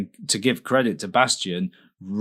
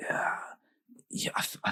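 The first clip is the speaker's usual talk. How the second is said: whisper; 12 dB quieter, much faster than usual. Real, not acted. Sudden unvoiced aside.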